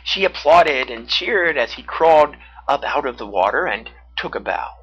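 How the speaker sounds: plain reading voice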